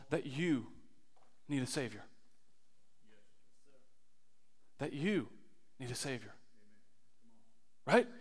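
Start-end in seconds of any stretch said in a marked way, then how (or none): 0.1-6.3 much softer and lower, near a whisper; hushed. Real, not acted. Preacher confronting each listener, hushed and grave. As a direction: quietly press it into each listener.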